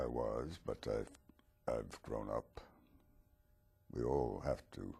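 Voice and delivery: ordinary talk, nothing marked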